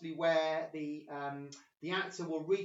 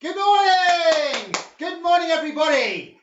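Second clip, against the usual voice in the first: calling out; 9 semitones higher, 14 dB louder than usual. Real, not acted. Host opening the online drama session, calling out high and loud. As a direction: holler it out to the whole group.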